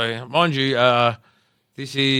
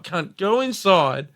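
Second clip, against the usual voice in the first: emphatic insisting; 8 semitones higher, 7 dB louder than usual. Retold or imitated. Insisting to a disbelieving mate about last night, emphatic insisting. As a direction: press him to believe you.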